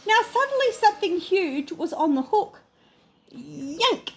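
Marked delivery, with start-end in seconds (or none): none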